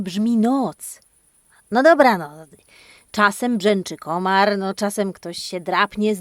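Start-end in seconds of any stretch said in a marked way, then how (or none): none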